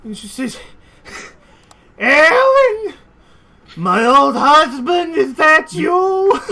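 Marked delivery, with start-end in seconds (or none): 2.0-6.4 put-on very high, loud, slow old woman; old aunt thinks the turtle is her late husband; doting old widow. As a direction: gush over a long-lost husband. Aunt mistakes dragon turtle for husband.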